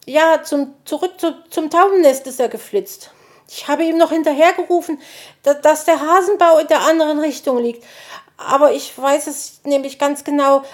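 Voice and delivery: high, loud, hurried and halting; flustered explaining. A narrator's reading. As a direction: explain urgently, stumbling over the start. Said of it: "erklärte Borstel".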